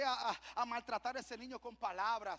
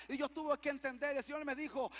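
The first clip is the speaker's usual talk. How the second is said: ordinary talk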